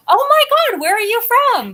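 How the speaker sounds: high, gushing re-enactment of a question; barreling excited questioning. Retold or imitated. Guest re-enacts pouncing on a man with an accent, excited and high. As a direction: burst in with eager curiosity, pitched well up. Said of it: "I just pretty much barreled the guy".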